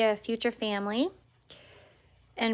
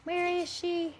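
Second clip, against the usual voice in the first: puppy voice; 6 semitones higher, 5 dB quieter, slower than usual. Pretend. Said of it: "Ronan's like".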